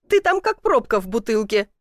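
lightly voiced teasing jibe; relentless teasing. A narrator's reading. Woman mocking man stuck in chimney, teasing. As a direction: tease him, carried away with amusement. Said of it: "не унималась она".

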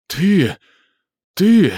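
high, loud furious roar; terrible roar. A narrator's reading. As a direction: roar it out in horror. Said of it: "проревела она жутким голосом".

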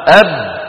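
أب with the lips shut tight, nothing escaping; held ba demo. Shown as a demonstration. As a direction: close firmly on the ba of أب. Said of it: "طيب الباء". (أب بباء شديدة محبوسة)

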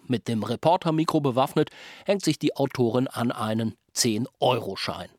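ordinary talk

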